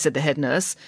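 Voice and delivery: ordinary delivery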